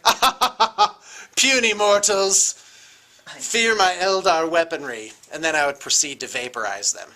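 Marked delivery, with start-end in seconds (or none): 0.0-5.1 cackling cosmic villain; evil villain gloat. Retold or imitated. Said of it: "I'd be like".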